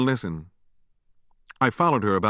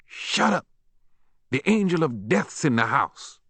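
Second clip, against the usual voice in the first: put-on angry old granny; 5 semitones higher than usual. Granny slaps and snaps at boy.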